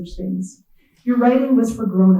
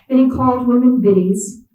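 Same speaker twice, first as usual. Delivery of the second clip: ordinary delivery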